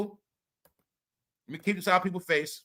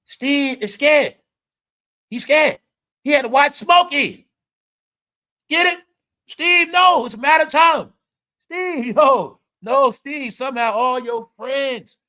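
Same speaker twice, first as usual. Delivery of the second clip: higher, louder teasing call-out; raised excited taunting; loud mocking tease; 7 semitones higher, 7 dB louder than usual. Real, not acted. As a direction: yell at Steve half laughing. Teasing Steve Harvey loudly.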